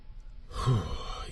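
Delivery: soft unvoiced sigh of dread; uneasy sigh. A narrator's reading. Narrator sighing softly before the dreaded stockroom. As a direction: breathe out the dread before going in.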